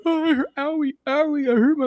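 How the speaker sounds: very high mock whine of a hurt kid; screaming hurt child. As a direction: imitate a little boy whimpering his complaint. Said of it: "I hear him screaming".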